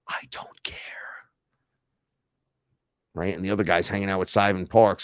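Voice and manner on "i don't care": breathy whisper, barely voiced; whispered I don't care to himself. Real, not acted. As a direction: drop to a dismissive whisper. Dismissive aside after rant.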